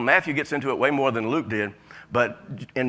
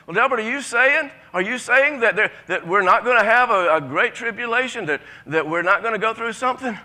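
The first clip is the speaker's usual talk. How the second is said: a hint of a doubtful listener asking; questioning church member; 6 semitones higher than usual. Preacher voicing a member who questions him, mildly doubtful. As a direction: lightly voice a puzzled congregant. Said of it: "voices a church member's question".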